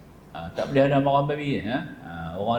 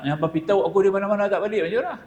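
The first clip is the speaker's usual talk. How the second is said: put-on higher, fast, urgent questioning old man; Tok Long pressing; 9 semitones higher, much faster than usual. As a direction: rush the worried questions up high. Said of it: "Tok Long kata". (suara orang tua bertanya dengan cepat dan cemas, nada tinggi)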